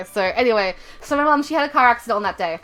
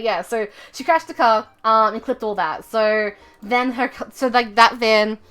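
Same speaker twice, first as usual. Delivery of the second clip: ordinary talk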